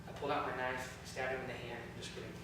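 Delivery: usual speaking voice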